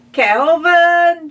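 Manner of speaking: high, loud scream of a name. Acted. Ma screaming for Calvin as pigs invade, high loud. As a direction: shriek it in alarm. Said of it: "she screamed".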